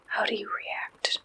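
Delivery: normal talking voice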